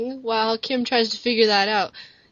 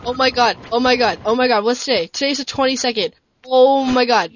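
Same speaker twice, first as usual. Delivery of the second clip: loud, breathless excitement; excited; 4 dB louder than usual. Real, not acted. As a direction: gush over the news. Excited outburst about show returning.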